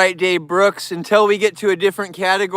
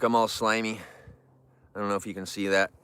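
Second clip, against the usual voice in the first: ordinary delivery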